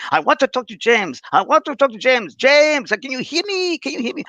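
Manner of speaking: high, hammed-up French accent; big Frenchman. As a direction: call out like a French spirit voice. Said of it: "you hear this big Frenchman".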